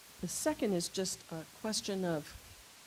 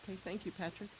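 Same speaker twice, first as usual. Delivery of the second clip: normal talking voice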